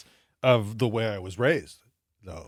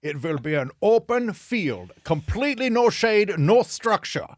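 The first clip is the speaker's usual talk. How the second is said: put-on stern German accent, rising higher and louder; 6 semitones higher, 5 dB louder than usual. Great-great-grandfather forbidding shade in an open field, emphatic German accent. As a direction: lay down the law in a German accent, building. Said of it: "That's good German accent".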